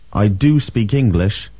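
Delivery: "I do speak English" with weight on "do"; emphatic do. Shown as a demonstration. Teacher models the new emphatic form, stressing "do". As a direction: lean firmly on "do". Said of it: "Emphatic do".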